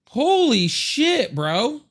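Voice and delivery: high, slow drawn-out exclamation; stunned. Real, not acted. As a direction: stretch it out in disbelief. Reacting to a screaming kid.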